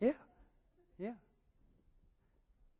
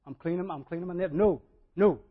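rising no-no-no protest; refusing Peter; 4 semitones higher, 14 dB louder than usual. Retold or imitated. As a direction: Peter refusing to lend his boat, climbing loud. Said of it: "Peter goes".

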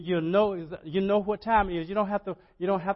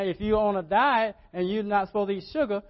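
ordinary delivery

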